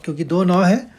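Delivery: ordinary delivery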